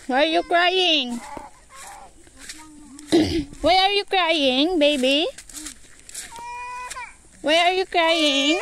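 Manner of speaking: high, drawn-out cooing. Real, not acted. Cooing at crying baby.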